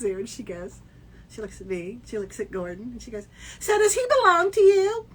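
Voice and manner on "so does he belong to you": high, loud mock-innocent question; put-on high voice, prying; mimicked brazen guest. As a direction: imitate a forward woman asking, pitched way up. Imitating woman asking about husband.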